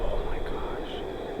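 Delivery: breathless scared whisper; real hushed fear; frightened whisper. Real, not acted. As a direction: whisper it, spooked by the dark. Scared during ghost hunt.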